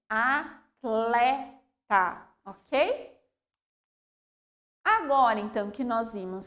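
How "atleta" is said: "Atleta" very slow, each consonant sounded; slow careful word. Shown as a demonstration. Teacher closing the example with a slow model. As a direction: say "Atleta" slowly, T and L clear. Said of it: "cada uma com o seu som".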